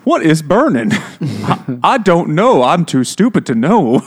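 over the top. Retold or imitated. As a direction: ham it up like a servant acting stupid. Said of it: "you have to be really over the top".

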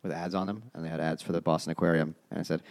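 normal talking voice

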